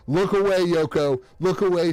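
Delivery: loud, high call of warning; playful yelled warning; mock urgent call. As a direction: shout a warning at the card. Calling out to card character.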